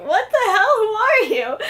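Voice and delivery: high, loud, indignant what the hell; Betty snapping back at Tony, shocked and loud; shocked outrage. Retold or imitated. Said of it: "Betty's just like".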